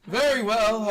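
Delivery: loud, high, proclaiming agreement; booming concession from an armored foe; armored villain. Acted. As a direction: declare it grandly to the challengers. Armored villain grandly agrees to answer.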